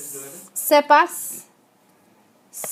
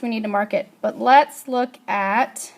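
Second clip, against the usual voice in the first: usual speaking voice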